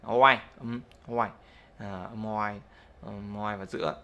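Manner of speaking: "oai" drawn out slowly; teacher models the linking sound "oai" slowly; inserted Y sound. Shown as a demonstration. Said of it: "thêm phụ âm I dài".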